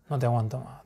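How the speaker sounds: "no te aguanto más" dropped low and very soft. Shown as a demonstration. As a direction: breathe "no te aguanto más" almost silently. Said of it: "en distintos tonos". ("no te aguanto más" muy bajito y suave)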